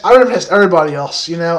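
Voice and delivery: ordinary talk, nothing marked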